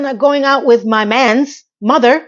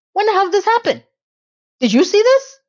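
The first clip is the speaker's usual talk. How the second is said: much higher, exasperated; exasperated outburst; 9 semitones higher than usual. Real, not acted. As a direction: throw the question up in disbelief. Outraged disbelief at the plot.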